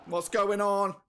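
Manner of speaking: angry outburst. Real, not acted. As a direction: snap at the glitching game. Game glitch rage.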